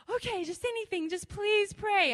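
high, insistent mock pleading; imitated begging. Persistent people begging her to pray, mock pleading.

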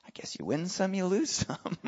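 whispered, resigned aside; wry whisper. Acted. Preacher shrugging to himself after the miracle, whispered. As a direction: half-whisper it to yourself.

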